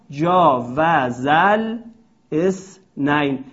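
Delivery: جاوزل very slow, then اثنین with its hamza kept, esnayn. Shown as a demonstration. Teacher reciting the poet's line with the hamza kept.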